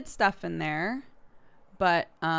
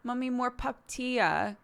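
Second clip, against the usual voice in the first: put-on toddler voice; 7 semitones higher than usual. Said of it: "she always goes".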